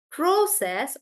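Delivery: "process" stressed on the first syllable, PRO-cess; noun stress. Shown as a demonstration. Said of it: "when the word process is a noun".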